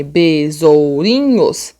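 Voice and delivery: BESOURINHOS very slow, syllable by syllable; separated syllables. Shown as a demonstration. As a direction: pull BESOURINHOS apart slowly. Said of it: "eu já falo separado".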